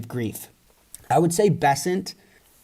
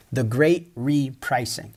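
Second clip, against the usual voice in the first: slow emphatic slogan; 3 semitones lower, slower than usual. Real, not acted. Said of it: "slow emphatic slogan".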